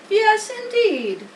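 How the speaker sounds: a sly rat savoring the word; Templeton the rat. Acted. Templeton answering Wilbur, slow high rat voice.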